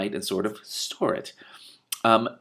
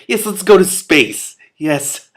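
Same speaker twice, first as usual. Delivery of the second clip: higher, louder and slower, gushing; mock excitement; 8 semitones higher, 10 dB louder, slower than usual. Real, not acted. Reader playfully egging on the dream, louder and higher. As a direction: cheer the idea on in jest.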